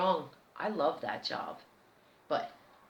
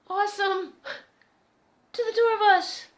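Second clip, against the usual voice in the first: delighted; 13 semitones higher, 8 dB louder than usual. Retold or imitated. Thrilled he helps carry beer.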